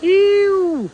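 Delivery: high, loud drawn-out ew; disgusted ew. Real, not acted. Reacting to the fog for mom, loud disgusted ew. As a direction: recoil with a loud ew.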